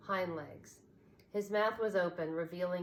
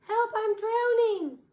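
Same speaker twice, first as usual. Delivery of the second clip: much higher, louder, slow desperate cry; panicked cry; 11 dB louder, slower than usual. A narrator's reading. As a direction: shriek for rescue. Rabbit drowning in pond.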